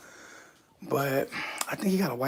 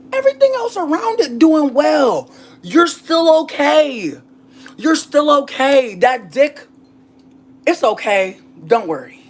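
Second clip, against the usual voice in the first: reassuring; 10 semitones higher, 9 dB louder, slower than usual. Pretend. High soothing reassurance.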